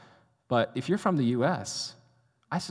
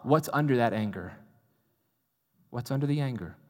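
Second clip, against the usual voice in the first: usual speaking voice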